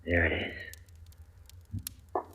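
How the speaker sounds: very slow, almost breathless whisper; hushed dread. Acted. Husband sees the pills and breathes it, slow and hushed. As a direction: barely let it out, slowly.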